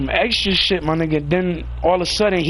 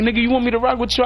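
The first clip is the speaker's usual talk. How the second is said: fast retort; 6 semitones higher, faster than usual. Retold or imitated. Quick retorted offer.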